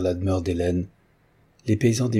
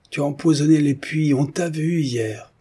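accusation; 8 semitones higher than usual. A narrator's reading. Villager accuses Hélène in the story.

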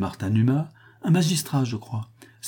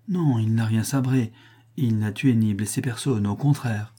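ordinary delivery, nothing marked

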